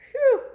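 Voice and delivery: very high, loud relieved puff; phew. Acted. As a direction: blow out a big phew. After the roof falls.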